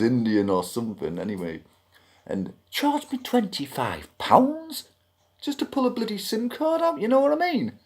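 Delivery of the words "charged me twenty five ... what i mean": high-pitched indignant complaint; incredulous outrage. Real, not acted. Customer griping about a shop's fee, incredulous. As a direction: shoot the pitch right up in disbelief at the charge. Outraged at price of small job.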